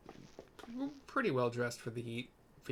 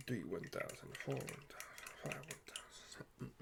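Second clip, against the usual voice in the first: counting under breath; 12 dB quieter than usual. Real, not acted. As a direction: count it off quietly, sinking into a whisper. Counting out the seconds.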